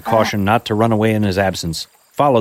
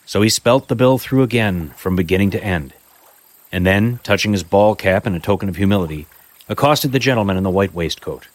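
story narration; 6 dB louder than usual. A narrator's reading. Reads a Dickens story aloud.

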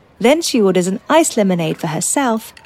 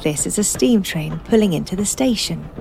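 guided meditation; 13 dB quieter than usual. Acted. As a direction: guide them gently into calm. Names sounds in the meditation.

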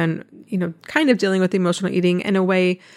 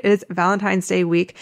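ordinary talk

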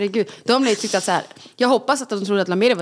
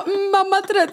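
put-on high, childish echo; kids echoing; 11 semitones higher, slower than usual. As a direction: parrot it back like a small child. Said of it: "De bara". (högt barnsligt eko)

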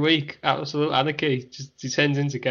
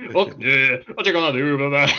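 louder, fast mock Scottish Hogan; Scottish Hulk Hogan; 5 dB louder than usual. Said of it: "imagine a Scottish Hulk Hogan".

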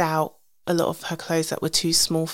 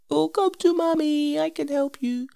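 comforting mum; 10 semitones higher than usual. Retold or imitated. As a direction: soothe a crying toddler. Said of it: "and I was just like".

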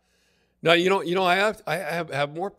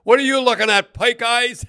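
much higher and louder angry shout; furious yell; 11 semitones higher, 9 dB louder than usual. Retold or imitated. Angry teammate snapping at the onlooker across the card game. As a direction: bark it out like a furious player. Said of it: "he's so mad".

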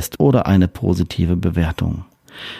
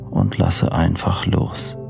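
soft, slow sleep meditation voice; guided meditation; 7 dB quieter, slower than usual. Acted. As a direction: lull the listener toward sleep gently. Freigesprochene Einschlafmeditation.

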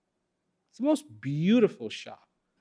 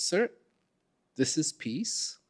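gentle shopkeeper; 8 dB quieter, slower than usual. Acted. Shopkeeper shows the shelves.

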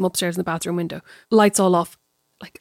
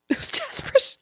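laughing; 4 dB quieter than usual. Real, not acted. Laughing at the affair story.

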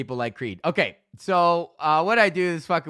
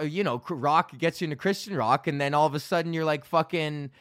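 normal talking voice

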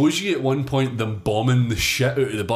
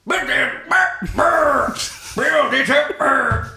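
mock barked order; 12 semitones higher, 4 dB louder, much slower than usual. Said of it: "repeating the order mockingly".

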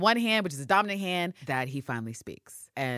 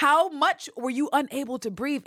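incredulous; 11 semitones higher, 6 dB louder than usual. Real, not acted. Mock incredulous question.

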